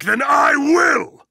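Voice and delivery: ordinary talk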